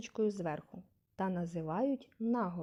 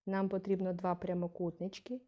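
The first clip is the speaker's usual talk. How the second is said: normal talking voice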